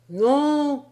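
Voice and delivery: high, loud cry; shouting. Acted. Sailor shouting as he nears the pound, loud cry. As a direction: yell it at the top of your lungs. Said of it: "criait-il à tue-tête".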